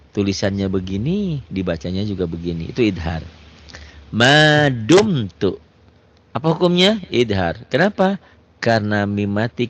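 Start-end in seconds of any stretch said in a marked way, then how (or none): 4.1-5.6 slow, melodic tajwid chanting; chanted Quranic reading; Quran recitation. Shown as a demonstration. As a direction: recite it slowly with drawn-out vowels. Recites example word.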